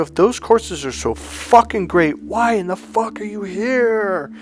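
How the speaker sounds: pitched high, slow on the last line; retold scream. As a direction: confront him hard. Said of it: "I screamed this at him".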